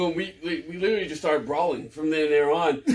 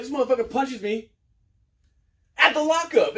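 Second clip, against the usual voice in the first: retold angry complaint; 8 semitones higher, 4 dB louder than usual. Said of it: "And I was like".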